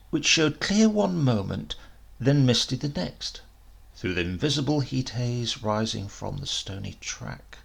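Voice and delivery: plain reading voice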